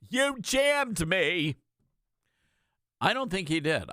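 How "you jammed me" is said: higher, slow, indignant Southern senator voice; riled-up Lindsey Graham. Retold or imitated. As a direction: ham up the wounded Southern senator, much higher and drawn out. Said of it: "Lindsey Graham from South Carolina on the floor".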